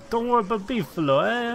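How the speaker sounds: high mock New York Italian accent; beefalo accent. Friend joining the joke, high mock New York beefalo accent. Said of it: "My beefalo accent".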